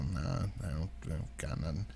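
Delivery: low, quiet brush-off; low dismissive reply. Retold or imitated. Turning down a homeless man asking for cash, low and dismissive. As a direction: mumble it low, under your voice.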